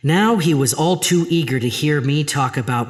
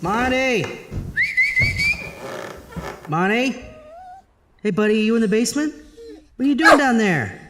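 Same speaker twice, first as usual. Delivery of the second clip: high calling out across the house; calling the dog; 13 semitones higher than usual. Acted. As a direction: call the dog up from the basement.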